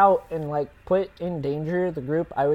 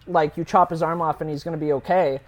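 ordinary delivery

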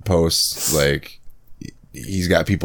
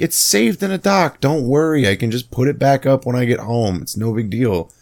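higher, lightly sing-song reassurance; mock breezy reassurance; 7 semitones higher than usual. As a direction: brush it off in a cheerfully high voice. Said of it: "And I'm like".

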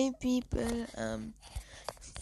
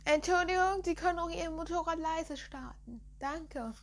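calling out; 7 semitones higher, 4 dB louder than usual. Real, not acted. Yelling at a loud motorcycle.

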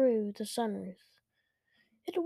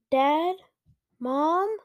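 higher, questioning calls with rising ends; anxious calling out; 5 semitones higher, 5 dB louder than usual. A narrator's reading. Child calling Dad and Mom under the monster, anxious. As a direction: call out for your parents, mildly alarmed.

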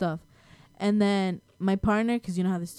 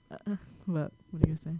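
talking while chewing ice; 3 semitones lower, 5 dB quieter, much faster than usual. Real, not acted. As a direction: mouth full of ice. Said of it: "Sorry, I'm chewing ice".

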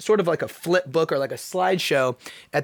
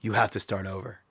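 stern self-talk; 7 semitones lower, 6 dB quieter than usual. Retold or imitated. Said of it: "I'm like".

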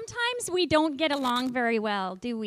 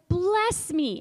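raised, pleading cry; teacher voicing Esau's plea to his father; mild shout; 5 semitones higher, 5 dB louder than usual. Shown as a demonstration. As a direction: call it out to the father. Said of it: "he actually shouted this out".

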